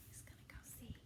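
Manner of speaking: soft unvoiced whisper to the listener; whispered aside. Real, not acted. Reader whispering an aside to the children. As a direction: lean in and whisper it.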